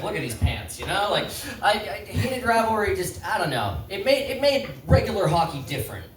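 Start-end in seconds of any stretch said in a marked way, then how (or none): none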